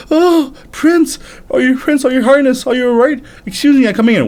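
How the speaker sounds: high, fretful calling; anxious servant. Acted. Servant fears prince is dead, high anxious calling. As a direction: call nervously through the door. Frightened servant calls through door.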